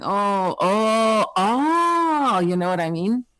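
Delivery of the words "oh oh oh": dawning realization. Retold or imitated.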